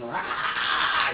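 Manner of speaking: short unvoiced mock baby roar; baby's angry roar. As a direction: imitate the baby's angry yell, breathy and short. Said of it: "he'd just look at me and".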